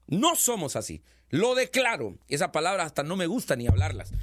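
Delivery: ordinary talk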